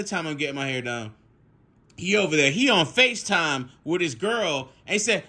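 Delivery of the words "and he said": ordinary talk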